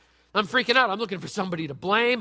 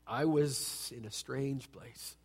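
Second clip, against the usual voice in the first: quiet; 7 semitones lower, 10 dB quieter, much slower than usual. Real, not acted. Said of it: "quiet slow reflection".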